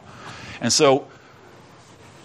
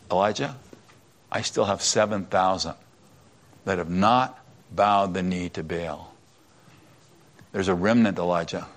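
gentle God; 6 semitones lower, 5 dB quieter than usual. Retold or imitated. God answering Elijah gently.

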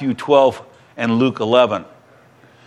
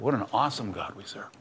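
much softer, reverent repeat; hushed echo; 4 semitones lower, 13 dB quieter than usual. Real, not acted. Preacher repeating his praise, much softer and reverent. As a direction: let it fall to a hushed awe.

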